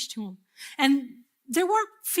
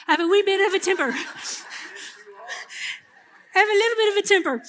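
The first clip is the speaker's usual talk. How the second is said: laughing, pitched up and rushing; giggling through the admission; laughing confession; 8 semitones higher, 3 dB louder, much faster than usual. Real, not acted. Laughing as she admits her temper to the congregation.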